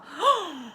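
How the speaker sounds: high, sudden mock gasp; surprised gasp. Shown as a demonstration. Teacher showing kids surprise, surprised gasp. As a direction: suck in air as if astonished. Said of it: "when we're surprised".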